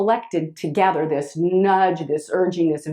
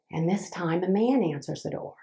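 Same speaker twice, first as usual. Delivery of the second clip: usual speaking voice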